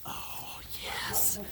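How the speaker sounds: excited whisper. Real, not acted. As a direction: whisper a little victory. Quiet celebration inside tent.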